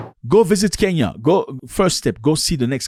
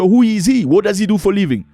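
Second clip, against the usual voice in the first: a bit higher and louder, probing; mock suspicious question; 6 semitones higher, 4 dB louder than usual. Relatives grilling the bride about her husband, mock suspicious. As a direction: demand to know who he is.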